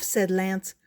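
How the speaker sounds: ordinary delivery, nothing marked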